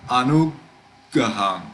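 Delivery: Anugaham said slowly with a long stop on the g; pause on double consonant. Shown as a demonstration. Teacher repeating for learners, long pause at the g in Anugaham. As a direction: halt at the g of Anugaham, then release gaham. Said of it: "we had to pause there".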